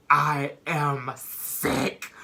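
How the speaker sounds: very slow, dragged words; slow drawn-out quote. Retold or imitated. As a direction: stretch every word out. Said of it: "she said".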